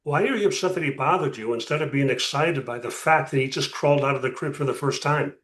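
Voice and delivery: ordinary talk, nothing marked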